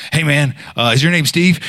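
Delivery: high-pitched nervous approach, imitated; acting out a jittery friend. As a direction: imitate an anxious, tentative question. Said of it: "he's real nervous".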